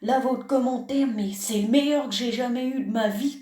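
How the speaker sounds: ordinary talk, nothing marked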